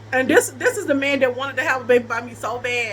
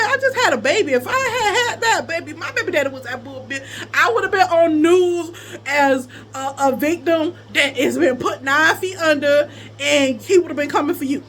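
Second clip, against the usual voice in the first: raised-pitch, forceful self-defense; indignant retort; 3 semitones higher, 4 dB louder than usual. Retold or imitated. Woman retelling her defense to the crying man, indignant. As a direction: argue it back at him, pitch lifted.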